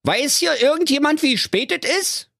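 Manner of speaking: very high, cartoonish bear with a Berlin twang; a lost, grumbling Berlin bear, voiced high and comic; squeaky bear talking Berlin slang. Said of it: "Der Berliner Bär fragte".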